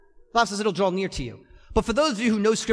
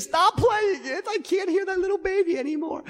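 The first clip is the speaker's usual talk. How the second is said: high, pleading voice of a tearful wife, raised to a cry on the last lines; imitating wife near tears; 11 semitones higher than usual. Wife begging husband to stop the clip, tearful high cry. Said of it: "She's like".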